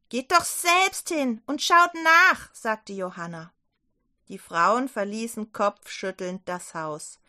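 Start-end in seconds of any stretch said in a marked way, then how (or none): 0.1-2.5 higher, louder, slow defiance; defiant retort. Acted. Johanna snaps back at the doubting men, loud and defiant. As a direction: challenge them.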